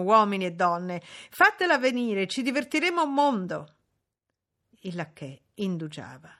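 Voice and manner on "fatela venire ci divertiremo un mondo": raised, eager clamour; excited cry. A narrator's reading. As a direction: call out high with party excitement. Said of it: "gridò tutta la gioventù".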